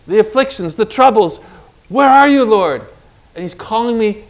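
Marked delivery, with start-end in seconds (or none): 1.9-2.8 raised, pleading call to the Lord; a desperate higher, louder plea; anguished cry. Acted. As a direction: cry out as if lost in a storm. Lost soul crying out to God.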